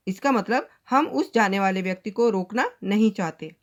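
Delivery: usual speaking voice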